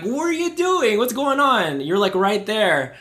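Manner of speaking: anxious panicked outburst. Retold or imitated. Anxious dad reacting to choking daughter, panicked. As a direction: blurt it out in a panic, voice jumping up. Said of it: "I'm like".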